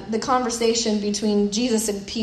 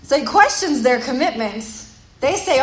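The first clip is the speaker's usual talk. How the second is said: ordinary talk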